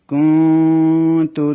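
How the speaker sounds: কুন তু chanted slowly, nun held in the nose; slow tajweed chant with a long nasal ghunnah; ikhfa nasal hold. Shown as a demonstration. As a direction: hum the nun of কুন for two counts before তু. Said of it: "নাকের বাঁশির মধ্যে নিয়ে দুই আলিফ সমান রাখতে হবে".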